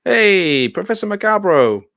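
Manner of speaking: loud, high call to the professor; calling out his name. Retold or imitated. Retelling how she called out to Professor Macabro, loud and high. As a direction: hail him brightly across the room. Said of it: "She did go".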